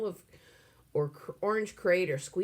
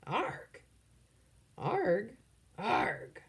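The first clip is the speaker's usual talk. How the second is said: pirate growl; 5 semitones higher, much slower than usual. A narrator's reading. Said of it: "He sounds like a pirate".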